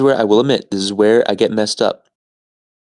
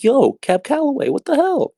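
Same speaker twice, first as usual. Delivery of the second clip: squeaky, much higher shocked cry; mock shocked shriek, far higher. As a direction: squeal out the surprise. Brothers shocked at apparition.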